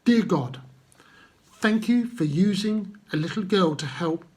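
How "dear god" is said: ordinary delivery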